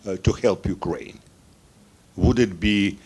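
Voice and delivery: ordinary delivery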